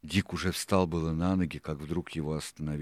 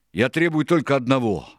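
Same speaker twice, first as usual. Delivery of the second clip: loud, high pirate demand; raised, insistent outburst; forceful demand; 9 semitones higher, 11 dB louder than usual. A narrator's reading. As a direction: demand it at full voice. Pirate making a fierce demand.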